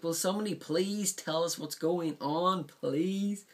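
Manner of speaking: high, loud mock panic; hammed-up plea for someone to explain; frantic commentator. Commentator begging the crew for answers, in loud mock panic. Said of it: "and goes like".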